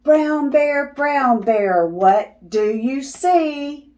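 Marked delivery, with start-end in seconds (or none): none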